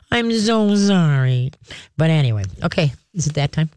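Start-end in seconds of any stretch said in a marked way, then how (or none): 0.1-1.5 slow, mock-sniveling sorry; sniveling apology. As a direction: whimper out a hammed-up apology. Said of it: "not as a little sniveling".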